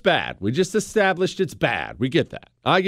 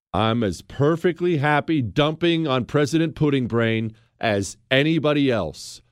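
normal talking voice

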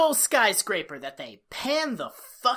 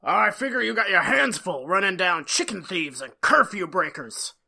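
sneering tycoon; 5 dB louder than usual. Retold or imitated. Quoting the villain's insult to the sheriff, a loud sneer. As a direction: sneer it down at the small-town sheriff.

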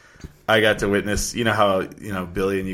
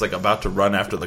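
normal talking voice

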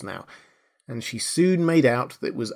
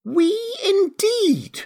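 very high, slow mouse squeal; indignant mouse cry; much slower than usual. A narrator's reading. The trembling mouse cries at Alice, shrill and indignant. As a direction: squeal it out, drawn long and indignant. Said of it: "cried the mouse, who was trembling".

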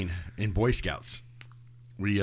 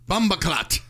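higher and louder Jamaican exclamation; loud patois shout; 8 semitones higher, slower than usual. Shown as a demonstration. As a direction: belt out Bomba clot, pitched up. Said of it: "Say this as loud as you can".

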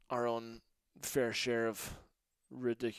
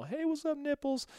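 teasing singsong; 13 semitones higher than usual. Retold or imitated. Teasing coach with dog name.

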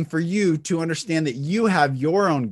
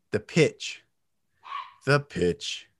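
'pitch' dropped low; low pitch; 3 semitones lower, 3 dB quieter, slower than usual. Shown as a demonstration.

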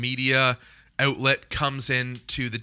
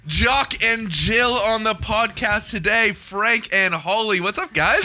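announcer voice; 6 semitones higher, 3 dB louder, slower than usual. Acted. Podcast intro announcer voice.

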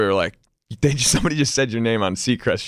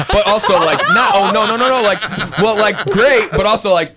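loud, high laughing backpedal; excited laughing; 10 semitones higher, 6 dB louder than usual. Real, not acted. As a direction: gush through laughter.

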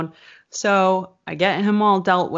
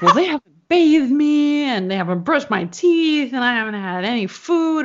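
higher, louder whiny mother; mother's list of demands sent via the friend, higher and whiny; complaining mom; 7 semitones higher, 3 dB louder than usual. Retold or imitated. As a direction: list the demands in a high, aggrieved voice.

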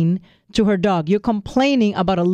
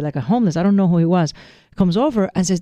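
ordinary talk

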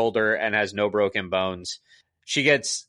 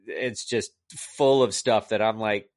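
ordinary talk

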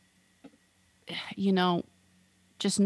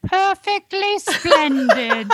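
high, slow British little-girl impression; girl saying "perfectly splendid"; 9 semitones higher, 4 dB louder, slower than usual. Teasing Kat about her British accent, imitated girl's voice. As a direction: draw it out like a prim English child. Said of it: "the girl that says "perfectly splendid".

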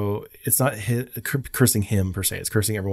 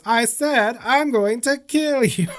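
much higher, slow toy voice; doll repeating threat; 13 semitones higher, slower than usual. Retold or imitated. Imitating the Krusty doll repeating its threat, high and slow. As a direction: spell the threat out slowly like a doll. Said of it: "then he goes".

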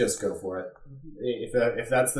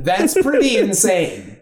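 laughing; 6 semitones higher, 5 dB louder, slower than usual. Real, not acted. Amused reaction to wild story.

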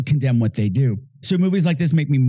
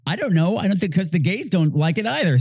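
normal talking voice

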